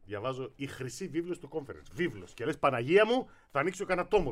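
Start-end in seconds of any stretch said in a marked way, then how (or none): 2.6-4.3 raised, louder mock exclamation; mock amazement. Host mocking the record book, mock amazement. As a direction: exclaim as if opening a great tome.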